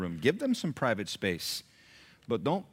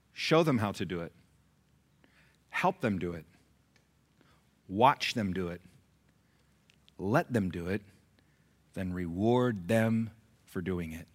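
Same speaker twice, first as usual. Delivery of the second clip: measured, lower, long pauses between steps; slow repeat; 4 semitones lower than usual. Shown as a demonstration. Preacher repeating the method for note-takers, slowly. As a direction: dictate each step for note-takers. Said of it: "I'll say it again".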